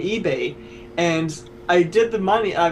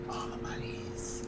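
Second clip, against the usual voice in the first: echoed whisper; 17 dB quieter than usual. Pretend. Echoing the joke.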